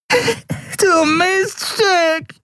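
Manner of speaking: very high, very slow howled curse; howling. A narrator's reading. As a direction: wail it out in pain. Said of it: "jaulte er".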